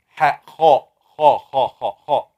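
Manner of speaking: حاء then خاء, rasped from the throat; letter drill. Shown as a demonstration. Quran teacher drills throat letters aloud. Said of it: "وسط الحلق".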